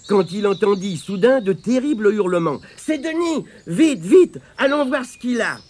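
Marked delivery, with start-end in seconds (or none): 2.8-5.6 urgent alarm. Acted. Alarm at terrible screams.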